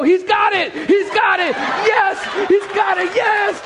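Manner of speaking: high, exultant church celebration; hammed-up jubilant cries; mock triumphant shouting. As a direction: imitate an excited congregation cheering a breakthrough.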